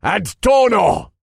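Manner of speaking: high, loud, drawn-out incantation; shouted spell. A narrator's reading. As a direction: shout it as you cast the spell. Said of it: "raised my hand at him and shouted".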